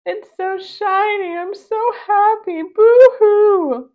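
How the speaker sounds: high, loud happy blubbering; crying tears of joy. Acted. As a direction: wail with joy over the new van. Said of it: "cried tears of joy".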